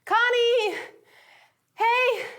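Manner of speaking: high, loud calls across distance; calling out. Retold or imitated. Steven and Connie calling each other across the wreckage. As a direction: shout to find each other.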